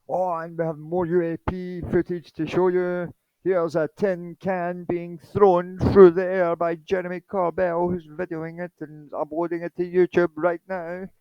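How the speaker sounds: high, sing-song parody newsreader; mock news presenter. News anchor presenting UAP footage, mock high newsreader voice. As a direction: put on a high-pitched TV news voice. Mocking mainstream news channels' reporting.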